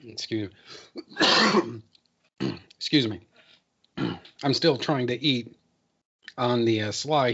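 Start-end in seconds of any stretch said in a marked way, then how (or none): 0.1-3.2 choked voice after a cough. Real, not acted. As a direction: speak through a tickly throat. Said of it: "went down the wrong pipe".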